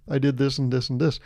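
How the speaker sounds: ordinary talk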